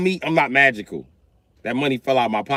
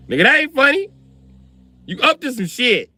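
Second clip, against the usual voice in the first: spooked scolding; 11 semitones higher, 3 dB louder than usual. Retold or imitated. Dad scolding laughing son.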